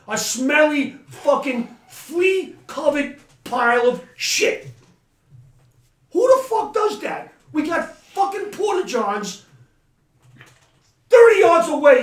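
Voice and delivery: loud, high disgusted yelling; angry shout. Real, not acted. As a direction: blow up at the mess. Raging about a mess behind tents.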